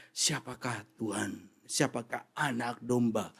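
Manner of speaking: soft, low, slow questions; awed murmur; hushed awe. Retold or imitated. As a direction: ask in reverent awe. Angels' words, hushed.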